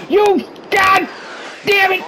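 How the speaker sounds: high-pitched exasperated curse; frustrated outburst. Real, not acted. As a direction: burst out cursing at the game. Cursing after a setback in game.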